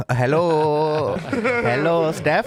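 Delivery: mock Indian accent greeting. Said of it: "in an Indian accent".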